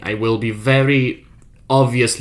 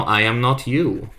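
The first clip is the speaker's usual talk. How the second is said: usual speaking voice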